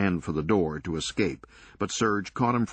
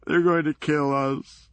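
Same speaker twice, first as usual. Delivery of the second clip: higher, louder, frightened sob of a line; tearful wail; 8 semitones higher, 5 dB louder than usual. Acted. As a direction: blurt it through tears. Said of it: "Doug began crying".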